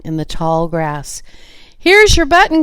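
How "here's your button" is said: high excited call of discovery; excited cry. A narrator's reading. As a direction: cry it out, thrilled to find it. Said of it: "cried Frog".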